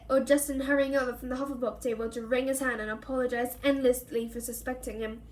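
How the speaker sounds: ordinary delivery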